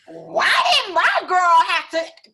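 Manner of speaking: loud, high, slow indignant quote of her partner; livid outburst. Retold or imitated. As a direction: voice him fuming at the clerk. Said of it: "and he was livid".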